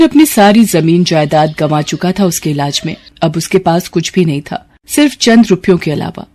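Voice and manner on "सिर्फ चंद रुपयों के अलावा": ordinary delivery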